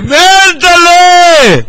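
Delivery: long high drawn-out call of מנדלה; hailing a friend at a party, drawn out. Real, not acted. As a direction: stretch the name out high across the room. Calling out to Mendele.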